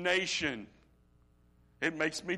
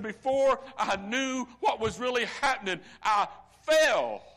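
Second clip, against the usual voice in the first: a retold confession, pitched higher and rising to a louder break; 6 semitones higher, 5 dB louder than usual. Preacher retelling a fallen friend's phone confession, mild breaking voice. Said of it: "very broken voice".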